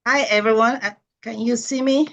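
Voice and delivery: ordinary delivery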